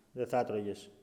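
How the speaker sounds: dropped low and soft, dismissive; quiet scornful retort. Shown as a demonstration. Preacher answering the boaster, low soft scorn. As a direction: brush off the boast under your breath. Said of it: "Λες τώρα εσύ ανοησίες".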